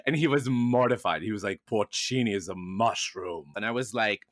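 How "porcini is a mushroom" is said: put-on slow, dry German-accented correction; a lightly voiced German accent, unimpressed; mock German accent. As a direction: imitate the disdainful German manager in a German accent. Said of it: "we completely butcher German accents".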